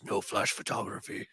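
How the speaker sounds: whisper. Pretend. Squatch says it to photographers.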